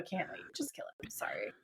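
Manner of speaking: quick, hushed whisper, a secret confession; whispered aside. Retold or imitated. Dean admits it quietly because his brother is watching, whispered aside. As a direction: drop to a whisper as if the brother might hear.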